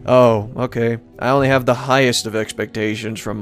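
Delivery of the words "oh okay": usual speaking voice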